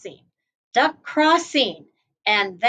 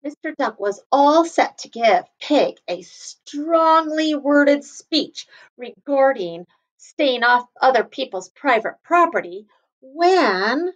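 ordinary delivery

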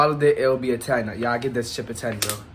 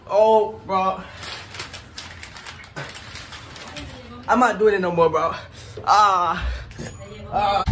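pained yelps; 7 semitones higher, slower than usual. Real, not acted. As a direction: cry out from the burn. Spicy chip pain reaction.

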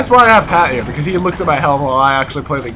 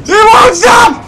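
very high, loud shout; alarmed yelling; 5 dB louder than usual. Pretend. Player as the enemy keeps coming, high alarmed shout. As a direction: yell in hammed-up panic.

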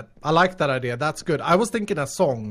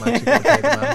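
laughing; 8 semitones higher, 8 dB louder, much faster than usual. Real, not acted. Laughing while paying.

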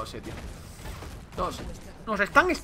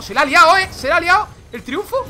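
high, loud hype at a big play; excited shout; 9 semitones higher, 10 dB louder than usual. Real, not acted. Streamer hyping a teamfight, loud excited yell. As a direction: yell it as the fight erupts.

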